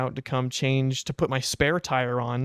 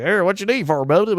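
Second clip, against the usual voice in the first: put-on old tire shop guy; 6 semitones higher, 5 dB louder than usual. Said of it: "and the guy's like".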